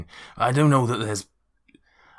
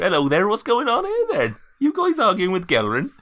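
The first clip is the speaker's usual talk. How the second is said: higher, louder Harriet voice; chirpy landlady barging in, pitched up; 12 semitones higher, 5 dB louder than usual. Acted. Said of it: "Harriet walks into the room".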